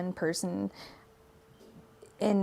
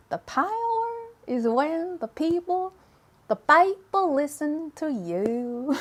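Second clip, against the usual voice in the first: slow, high, hammed-up fake Swedish accent; Trixie's bad Swedish accent; 7 semitones higher, slower than usual. Trixie giving a speech about power in a mock Swedish accent. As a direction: draw out a silly mock Swedish accent, pitched up. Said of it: "a decent impression of Trixie's bad Swedish accent".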